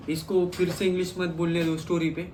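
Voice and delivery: ordinary talk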